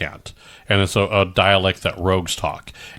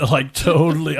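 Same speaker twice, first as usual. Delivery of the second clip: a higher, lightly voiced surfer totally; 7 semitones higher than usual. Joking with the cohost, a hint of surfer dude. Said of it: "talking to the surfer".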